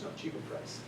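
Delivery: a flat, breathy mimic of the pushy factory brother; mock haggler's whisper. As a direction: whisper the one English phrase he knows, imitating him. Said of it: "would just look at me and say".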